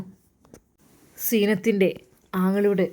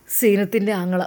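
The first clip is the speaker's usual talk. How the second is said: usual speaking voice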